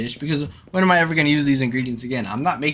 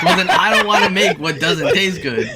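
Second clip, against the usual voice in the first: sarcastic retort; 6 semitones higher, 8 dB louder than usual. Retold or imitated. Mocking a snobby cook's reply.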